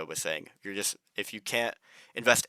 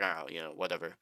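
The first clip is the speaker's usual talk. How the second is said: breathy, shrugging "whatever" with no voice behind it; mock indifference; 3 semitones lower, 6 dB quieter than usual. Imitating a careless investor, dismissively shrugging off the virus news. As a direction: brush it off like it doesn't matter.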